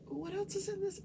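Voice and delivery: high-pitched, lightly put-on questioning; mock probing question. Defense lawyer questioning the wife, mock probing. Retold cross-examination of the wife.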